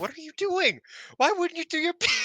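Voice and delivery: high-pitched, loud mock outrage; upset disbelief. Imitating the upset partner over the forgotten bit, mock outrage. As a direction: squeal it like an appalled tag partner. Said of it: "everybody was upset about it".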